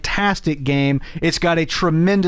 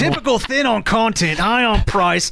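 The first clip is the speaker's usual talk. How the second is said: higher-pitched hammed-up Aussie read; mock Australian accent; 5 semitones higher than usual. Co-host takes over the listener's message, mock Aussie accent.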